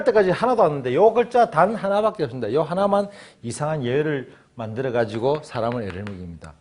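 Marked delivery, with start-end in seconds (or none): none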